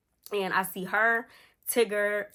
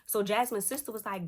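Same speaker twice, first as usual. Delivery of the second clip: normal talking voice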